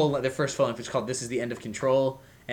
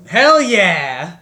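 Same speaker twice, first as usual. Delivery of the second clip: loud, whooping hell yeah; enthusiastic shout; 6 semitones higher, 12 dB louder than usual. Real, not acted. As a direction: cheer it out.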